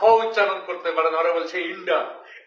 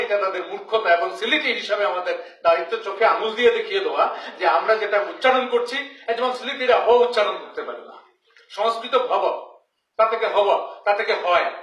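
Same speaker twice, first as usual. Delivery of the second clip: ordinary delivery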